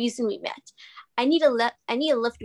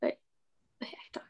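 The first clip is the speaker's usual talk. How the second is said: hushed whisper; 12 dB quieter, much faster than usual. Real, not acted. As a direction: drop to a whisper, unsure. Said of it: "wait, you wanna talk?".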